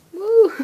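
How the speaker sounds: short, high, loud whoop; high celebratory whoop; excited whoop. Real, not acted. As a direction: let out a gleeful woo. Delighted that thing still works.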